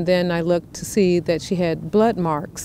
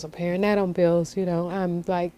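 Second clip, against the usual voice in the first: usual speaking voice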